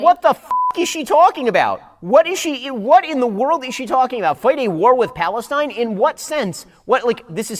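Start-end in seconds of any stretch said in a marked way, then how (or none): 0.0-3.7 baffled exclamation; incredulous. Real, not acted. Reacting to the clip in disbelief.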